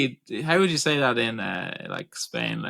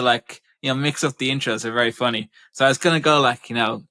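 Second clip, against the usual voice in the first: ordinary talk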